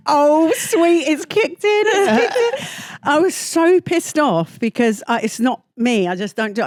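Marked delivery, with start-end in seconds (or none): 0.1-3.0 high, louder mock-sympathetic coo. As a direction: imitate friends cooing over a newbie, drawing it out. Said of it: "And everyone's like".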